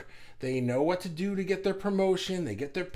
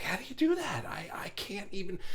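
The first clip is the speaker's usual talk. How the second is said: incredulous whisper; 3 semitones higher, 5 dB quieter than usual. Retold or imitated. Amazed at others watching news.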